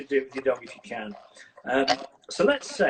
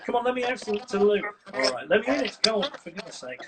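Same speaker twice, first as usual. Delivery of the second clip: demanding dog; 6 semitones higher than usual. Retold or imitated. Dog's demands voiced.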